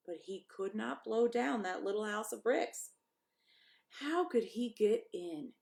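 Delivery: ordinary delivery, nothing marked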